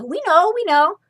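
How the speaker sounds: high, loud insistent cry; eager cry. A narrator's reading. Sisters insisting they saw the brother, crying out. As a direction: blurt it out desperately. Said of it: "cried those two sisters".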